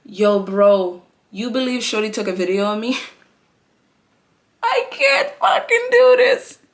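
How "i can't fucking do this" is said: much higher, slow squeal of disbelief; exasperated squeal. Real, not acted. Telling viewers the stylist filmed her, high exasperated squeal. Laughing at being filmed crying.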